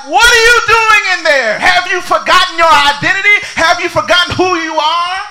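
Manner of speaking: loud, high, crying shout of God. Acted. God shouting into the cave at Elijah. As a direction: cry out to the lost prophet. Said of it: "And God is like".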